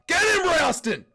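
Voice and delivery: loud, high yells urging his fighter on; shouting encouragement. Real, not acted. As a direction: roar at the screen like a fan ringside. Cheering his fighter live.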